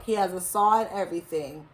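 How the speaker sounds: ordinary delivery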